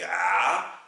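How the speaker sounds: A as a long, throaty Arabic aïn with fatha; Arabic aïn sound. Shown as a demonstration. Teacher demonstrating aïn with fatha, throaty A. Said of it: "qui va se prononcer".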